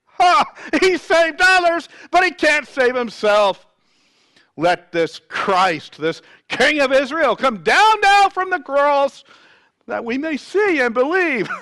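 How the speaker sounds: loud, high-pitched scoffing shout; mocking. A narrator's reading. Chief priests mock Jesus among themselves, loud scornful mockery. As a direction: scoff loudly with a derisive, high "Ha!". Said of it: "mocked him among themselves".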